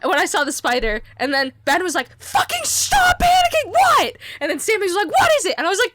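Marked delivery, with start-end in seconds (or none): 2.2-4.2 high, exasperated yell; imitated angry shout. Ben yells at panicking canoer, imitated exasperated shout. 5.1-5.5 high, loud alarmed cry; imitated panicked question. Sammy asks about the spider, high-pitched alarm.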